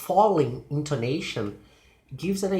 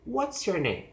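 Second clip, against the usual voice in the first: high "What's", falling to "name"; falling question; 6 semitones higher than usual. Shown as a demonstration. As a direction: stress "What's", then drop. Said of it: "they use falling intonation".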